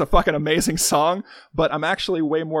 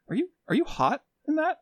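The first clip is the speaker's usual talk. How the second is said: mock stranger voice; 11 semitones higher than usual. Stranger on street, acted quote.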